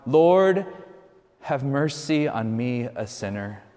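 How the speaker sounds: ordinary talk, nothing marked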